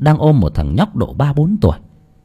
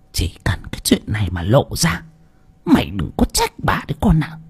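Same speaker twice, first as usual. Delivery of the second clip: menacing hush; 6 dB quieter than usual. Acted. Bà Phú túm tóc đe dọa.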